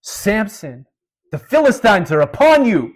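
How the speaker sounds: loud, high yell into someone's ear; acted-out shout to wake a sleeper; yelled alarm. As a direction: cry out a warning at full voice. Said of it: "she yells in his ear".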